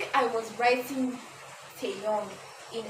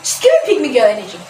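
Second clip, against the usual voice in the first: much louder roast; mock outrage; 16 dB louder, faster than usual. Real, not acted. Reader roasting the heroine, loud. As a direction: call her out loudly. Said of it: "judging the character".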